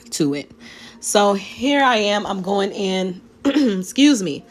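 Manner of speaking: normal talking voice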